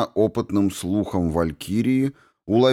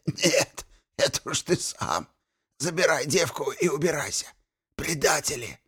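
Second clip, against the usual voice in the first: gurgling alien voice; 8 semitones higher, 5 dB quieter than usual. A narrator's reading. Archmage spits back, weak.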